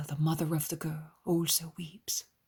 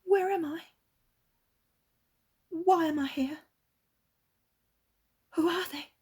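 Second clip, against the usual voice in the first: lightly voiced bewildered girl, higher; dazed girl; 13 semitones higher, 6 dB louder than usual. A narrator's reading. Freed girl asks where she is, a hint of amazement. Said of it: "calmly, sadly, asks questions".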